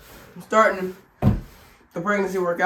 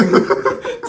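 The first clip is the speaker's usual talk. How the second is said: laugh; 6 dB louder than usual. Real, not acted. Laughs at forgetting direction.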